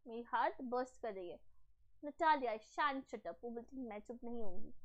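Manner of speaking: ordinary talk, nothing marked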